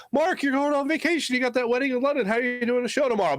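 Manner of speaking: high-pitched imagined listener objecting; high mock listener protest; questioning listener.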